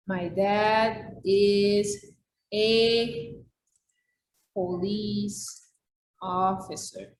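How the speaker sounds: very slow, word by word, each word set apart; slow model sentence. Shown as a demonstration. Teacher models the corrected sentence to students, very slow and deliberate. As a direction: dictate the correct sentence for the class. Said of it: "la forma de decir esto".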